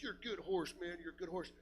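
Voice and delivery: soft, grateful murmur to the horse; quiet thanks after a scare; tender. Retold or imitated. Wiping tears, thanking the horse.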